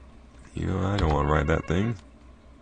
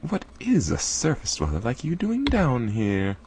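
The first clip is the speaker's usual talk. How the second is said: put-on higher, slow curious girl; Mala's voice; 9 semitones higher, slower than usual. Mala questioning the surface dweller, curious. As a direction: ask it with wide-eyed wonder. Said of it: "I don't know why I'm talking like that".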